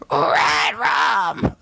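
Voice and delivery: high, loud screechy scream; mimicked scream. Shown as a demonstration. Singer showing the flexibility of screaming, screechy. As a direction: push out a tight-throated mimicked scream. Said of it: "just like how I just mimicked".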